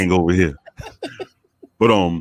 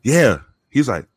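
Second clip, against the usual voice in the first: ordinary delivery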